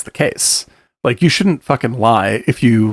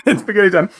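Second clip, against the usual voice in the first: laughing through a mouthful, higher; 6 semitones higher, faster than usual. Real, not acted. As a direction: giggle out the line while eating. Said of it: "Sorry, I'm starving".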